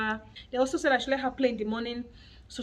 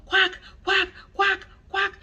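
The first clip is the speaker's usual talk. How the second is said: high, loud quack quack chant; child shouting quack; 8 semitones higher, 8 dB louder, slower than usual. Retold or imitated. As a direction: shout it like a toddler wanting a duck. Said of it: "She was just there shouting".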